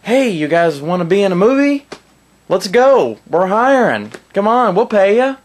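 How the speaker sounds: high, loud hustling pitch to strangers; eager recruiter. Retold or imitated. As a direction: call out like a sleazy casting scout. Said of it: "and just said".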